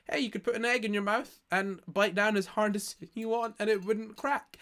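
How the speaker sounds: ordinary delivery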